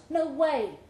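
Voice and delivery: plain reading voice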